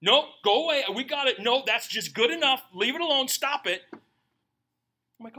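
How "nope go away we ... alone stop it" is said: high, loud shooing-away voice; bossy setup crew. Retold or imitated. Setup crew shooing the pastor away, mock bossy and loud. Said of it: "what did I get?".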